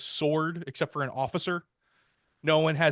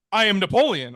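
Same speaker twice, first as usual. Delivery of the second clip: higher, louder self-announcement; mock grand proclamation; 6 semitones higher, 5 dB louder, faster than usual. Napoleon announcing himself to the customs inspector, mock proclamation. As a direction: proclaim it in mock earnest, imitating a would-be emperor.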